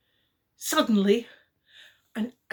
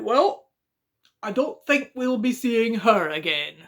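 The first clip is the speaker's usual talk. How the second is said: Daddy Bear; 3 semitones higher, 6 dB louder than usual. Acted. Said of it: "And Daddy Bear said".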